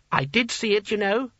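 high-pitched sulky little wizard. A narrator's reading. Small wizard insists to his leader, sulky. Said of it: "said the small wizard sulkily".

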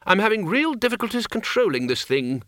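high, strained complaint; through gritted teeth. A narrator's reading. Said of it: "said Ridcully through gritted teeth".

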